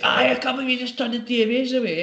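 high-pitched mock incredulity; retold disbelief. Colleague scolding barber over turning band away, mock disbelief. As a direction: imitate an appalled mate, pitched well up.

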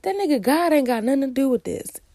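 high, loud, quick comeback; retold exasperated retort. Retelling her retort to friend.

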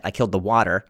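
usual speaking voice

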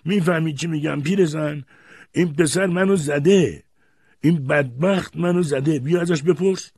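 outraged shouting. A narrator's reading. Grandfather tells grandmother the boy hit him, shouting. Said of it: "و فریاد زد".

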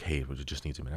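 soft low bouncer aside; quiet bouncer voice. Retold or imitated. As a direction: murmur it like security. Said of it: "Bubba was like".

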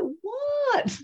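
baffled squeak; startled what. Retold or imitated. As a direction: caught off guard. Midwife surprised by the reaction.

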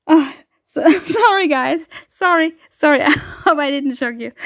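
high, giggly apology after a fright; flustered laughing apology. Real, not acted. Apologizing to listeners for the shock, giggling. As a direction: apologize through real shaken laughter.

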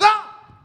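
sharp high yelp of alarm; a sudden scared shout; a loud high-pitched cry of fright; panicked scream. Acted. Son dives into the pool.

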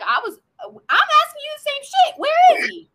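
high, loud exasperation; exasperated shout. Retold or imitated. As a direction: yell it back in disbelief. Bo exasperated asking same question.

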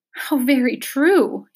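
understated tearful agreement; narrated sob. A narrator's reading. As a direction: draw it out mournfully, sobbing only lightly. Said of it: "he sobbed".

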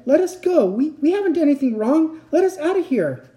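high, loud begging; high-pitched panicked appeal; desperate plea. A narrator's reading. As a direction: plead frantically to be let go. Cornered child pleads for release.

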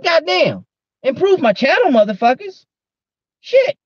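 high, loud outburst; sudden yell. Real, not acted. Yelling at chat.

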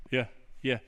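low, quiet, disengaged mumble; mock half-listening husband. Husband barely listening to his wife, mock-inattentive murmur. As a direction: toss it off as if not listening. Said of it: "don't sit there and go like this".

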